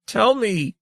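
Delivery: the plea breaks upward; hysterical plea. A narrator's reading. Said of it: "Saren was ashen and hysterical".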